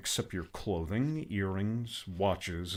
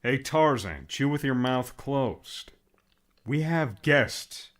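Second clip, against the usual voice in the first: disgusted; 4 semitones higher, 3 dB louder, slower than usual. Acted. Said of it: "with a look of disgust".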